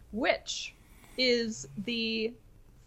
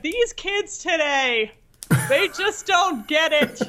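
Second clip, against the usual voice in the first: slow, raised-pitch mock complaint; crotchety old man; 6 semitones higher, 4 dB louder, slower than usual. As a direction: tease with a hammed-up crotchety old-timer, louder and drawn out. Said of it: "Could there be a more crotchety beginning".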